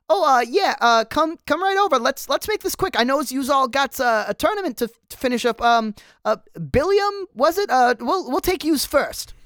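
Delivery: a startled little man rushing everyone; squeaky startled cameraman. Acted. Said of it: "He pipes up in a squeaky, startled voice".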